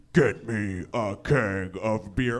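low, slow movie growl, kept soft; very bassy. Acted. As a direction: drop it deep and drag out each word. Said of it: "very bassy".

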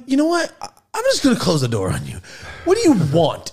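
loud, high-pitched fed-up retort; acted exasperation. Retelling his reply to a doorstep visitor, acted exasperation. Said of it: "And I'm like".

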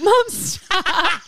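laughing plea. Retold or imitated. A daughter laughing as she begs her mom to stop.

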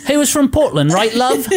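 high, hammed-up fake English accent; fake British accent. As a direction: tease with a put-on British accent. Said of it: "fake British accent".

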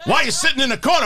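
loud, high, laughing Pacino voice; Al Pacino impression. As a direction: ham up Pacino's voice, breaking into laughter. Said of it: "Mister Pacino, are your kids".

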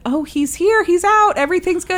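a hint of a bright, reassuring doctor; anesthesiologist's news. Anesthesiologist announces the baby, mock cheerful. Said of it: "the anesthesiologist said".

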